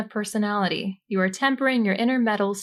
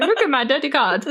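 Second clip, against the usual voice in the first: mock gleeful; 6 semitones higher, 4 dB louder than usual. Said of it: "I'm like".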